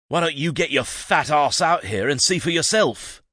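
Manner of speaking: loud, high rude yell; shouted back. A narrator's reading. Son shouts back at his father, loud and rude. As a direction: bellow it back. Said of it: "Jason shouted back".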